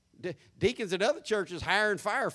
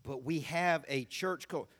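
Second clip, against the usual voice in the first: usual speaking voice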